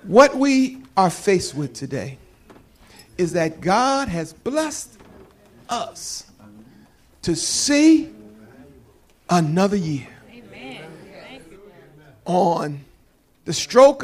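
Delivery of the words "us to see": ordinary delivery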